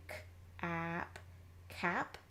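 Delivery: "k", "ap" apart, then blended into "cap"; sounded out. Shown as a demonstration. Teacher sounding out the first chunk, segmented then blended. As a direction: sound out "cap" in two pieces. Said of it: "start sounding this word out".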